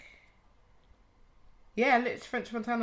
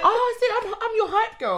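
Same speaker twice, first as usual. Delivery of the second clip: excited; 10 dB louder than usual. Real, not acted. Excited defending her hype.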